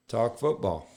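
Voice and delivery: normal talking voice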